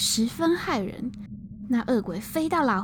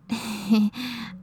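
put-on a short amused old monk's chuckle; soft chuckle. Old monk chuckles at the ghost, light amused laugh. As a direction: chuckle lightly before answering. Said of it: "笑了笑说".

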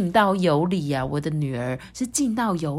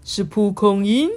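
slow puzzled mild guess; a hint of a hesitant king; 3 semitones higher, 5 dB louder, slower than usual. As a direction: guess uncertainly. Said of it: "国王就说".

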